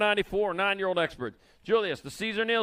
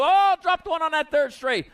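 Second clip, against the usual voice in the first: higher, louder alarmed call of a fall; excited call; 9 semitones higher, 7 dB louder than usual. Real, not acted. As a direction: call out the crash with a jolt. Rider falls on straightaway.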